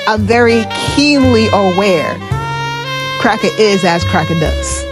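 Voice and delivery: normal talking voice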